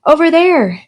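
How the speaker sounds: high pointing yell; girl yelling to the teachers. A narrator's reading. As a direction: call it out and point. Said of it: "yelled Alexia".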